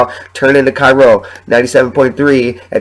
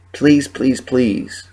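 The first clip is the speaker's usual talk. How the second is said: soft pleading; 2 semitones higher, 5 dB quieter, much slower than usual. Real, not acted. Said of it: "pleading with listeners".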